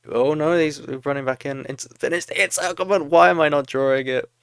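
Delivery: high, loud, frustrated outburst; exasperated shouting. Real, not acted. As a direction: yell at your own replay in rising disbelief. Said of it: "I'm shouting at my ghost self".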